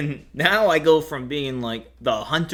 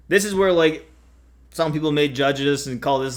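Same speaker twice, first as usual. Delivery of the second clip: usual speaking voice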